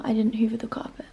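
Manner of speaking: quick, hushed confession; a sheepish aside, whispered fast; guilty whisper. Real, not acted. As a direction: whisper it so Mum can't hear.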